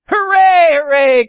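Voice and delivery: high, loud hooray; gleeful cry, falling from high; jubilant cheer. Acted. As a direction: shout it out in triumph.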